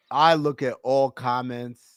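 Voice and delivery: ordinary talk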